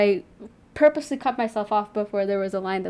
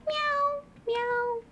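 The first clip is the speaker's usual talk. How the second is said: very high little kitten meows; high cat meow; 14 semitones higher, 7 dB quieter, much slower than usual. Pretend. Looking at a cat.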